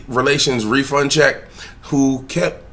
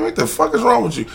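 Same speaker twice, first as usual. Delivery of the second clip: put-on kid's defiant stare; 11 semitones higher than usual. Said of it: "Looking at me, I mean".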